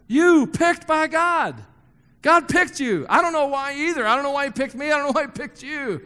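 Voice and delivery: rising sermon fervor; fervent preaching. Acted. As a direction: preach it over the crowd. Preaching builds.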